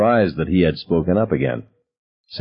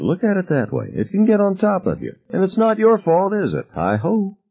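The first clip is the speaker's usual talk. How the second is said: mocking taunt; 13 semitones higher than usual. A narrator's reading. Taunting Ted about his mother.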